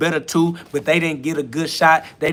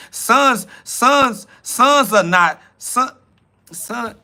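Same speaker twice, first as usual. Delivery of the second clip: higher, sputtering ranting; heated stammer; 7 semitones higher, 4 dB louder, much slower than usual. Real, not acted. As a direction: trip over the word in frustration. Heated rant stumbling on sons.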